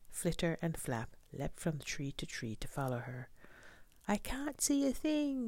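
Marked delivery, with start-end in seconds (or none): 4.1-5.5 high-pitched storybook yell without much volume; small bird's thin, high yell; panicked yell. A narrator's reading. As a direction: cry out high in alarm. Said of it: "yelled Pip".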